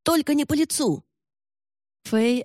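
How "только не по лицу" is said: high-pitched frightened protest; panicked pleading. Acted. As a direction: beg to be spared a blow. Captive begs not to be hit.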